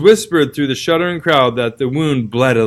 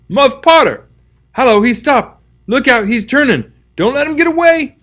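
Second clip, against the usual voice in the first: high, loud warning shouts; crowd yelling; 11 semitones higher, 5 dB louder than usual. Acted. Villagers warn each other not to let Potter escape, loud high yells. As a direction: yell a warning across the graveyard.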